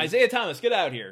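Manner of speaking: loud, high mock summons; high-pitched playful shout; calling out. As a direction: holler it out as a running joke. Jokey callback, calling someone out.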